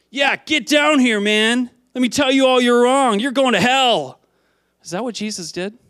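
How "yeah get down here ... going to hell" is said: loud, high-pitched hammed-up condemnation; mock wrathful shout. Preacher acts out a God who hates sin condemning Zacchaeus, wrathful shout. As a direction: yell it down like a mock wrathful judge. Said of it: "is wrathful against sin".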